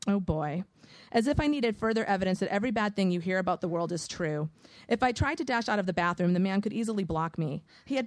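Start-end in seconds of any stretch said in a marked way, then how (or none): none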